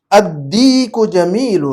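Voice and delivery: very slow, drawn-out Arabic recitation; model reading. Shown as a demonstration. Teacher reading a sentence for translation, very slow model reading. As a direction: read it out measured, stretching long vowels. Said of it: "جملہ پڑھتا ہوں".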